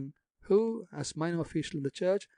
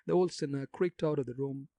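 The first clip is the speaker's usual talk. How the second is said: usual speaking voice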